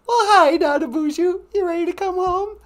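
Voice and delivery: very high put-on sweetheart voice; high-pitched Natasha character. Natasha asking if he will come home, high put-on voice. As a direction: chirp a welcome in loud falsetto.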